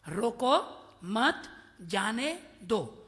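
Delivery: "रोको", "मत", "जाने दो" set apart by pauses, slowing at the end; word-by-word demonstration. Shown as a demonstration. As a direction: count out each word separately: "रोको" / "मत" / "जाने" / "दो". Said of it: "चार शब्द है, आप देखो".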